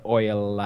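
oil with a full tongue-tip L; oil, softly, the L fully sounded; carefully enunciated oil. Shown as a demonstration. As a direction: end oil on a released "la". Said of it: "You have to do this la".

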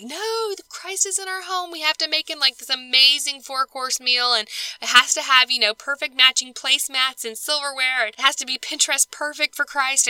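high, frazzled rush of a perfectionist host; frantic fussing. Retold or imitated. Mocking her worked-up self fussing over the meal, high and frazzled. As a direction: wound up over a perfect dinner for Christ. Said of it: "I would get all worked up and be like".

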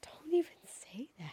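breathless whispered plea; dread whisper. Real, not acted. Dreading reply.

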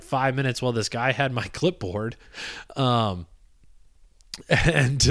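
ordinary talk, nothing marked

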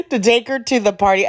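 a little hoarse, something in the throat; hoarse smoker voice. Real, not acted. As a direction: talk through a rough throat. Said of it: "I sound like a smoker".

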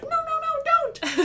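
very high, squeaky little mouse; panicky mouse. Acted. Mouse warning the boy off, high panicked squeak. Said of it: "but the mouse is like".

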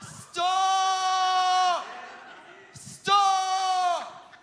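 loud, high, long-held yell; retold scream. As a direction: drag the word out in a high re-enacted scream. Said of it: "And I'm screaming".

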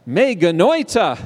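emphatic, indignant cry; punchy exclamation for the audience to copy; exclaimed like "oy gevalt". Shown as a demonstration. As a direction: cry it out as a Yiddish-style lament. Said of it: "say it like, Oi gewalt".

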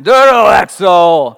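very loud, slow booming "Doro exo"; epic Greek command. Shown as a demonstration. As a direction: roar "Doro exo" for full effect. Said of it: "That sounds epic when you say it in Greek".

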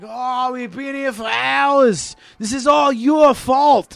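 slow, high whining complaint; mock Australian whinge; whiny Australian kid. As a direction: blame the sister in an Aussie whine. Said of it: "he's yelling at his little sister".

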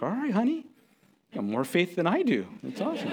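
high, bemused dad to daughter; amused dad. Retold or imitated. Dad conceding to his fearless daughter, high and bemused. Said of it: "I'm like".

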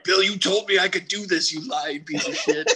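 put-on high, pleading rant at his old boss; mock desperate coach. Fired coach ranting at Bill in a high pleading whine. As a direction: whine it like a betrayed ex. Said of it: "Matt Patricia being like".

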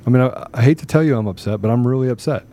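normal talking voice

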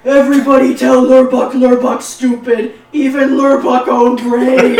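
loud, booming broken-English brute; dumb orc voice. Acted. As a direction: boom it out like a hurt dumb orc. Orc character laments.

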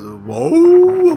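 high, loud howling whoa; dog howl. Pretend. Dog howls.

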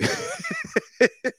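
sudden squeaky high laugh; high squeal laugh. Real, not acted. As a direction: burst into a shrill giggle. Laughs at own voice.

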